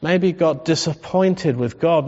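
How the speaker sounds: ordinary talk, nothing marked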